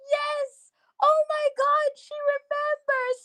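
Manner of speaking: reenacted high-pitched elation; so excited. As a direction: relive the thrill, voice right up high. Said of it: "I was so excited".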